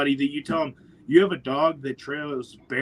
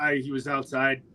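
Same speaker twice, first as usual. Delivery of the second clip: ordinary talk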